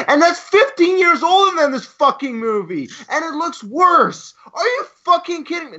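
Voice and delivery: outraged at how bad it looks, voice climbing; exasperated rant. Real, not acted. Ranting to cohosts about the CGI, incredulous and high.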